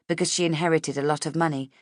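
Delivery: usual speaking voice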